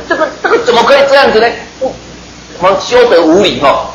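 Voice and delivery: hammed-up disciples sputtering with offence; indignant outburst. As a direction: disciples outraged at being refused a meal, hammed-up sputtering. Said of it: "所以那两个学生不高兴啊".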